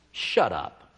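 low, quiet "Shut up."; blunt shut up. Retold or imitated. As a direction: drop the voice right down on it. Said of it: "He does".

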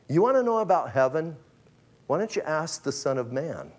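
ordinary talk, nothing marked

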